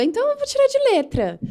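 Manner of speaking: high, quick singsong boast; breezy overconfidence. Retold or imitated. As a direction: toss it off as if nothing could be easier. Quotes her naive past self boasting.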